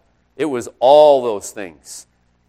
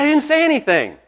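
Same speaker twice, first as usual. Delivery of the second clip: much higher, incredulous cry of innocence; incredulous protest; 13 semitones higher, 3 dB louder than usual. Retold or imitated. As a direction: plead that you said nothing. Coach protesting to the ref.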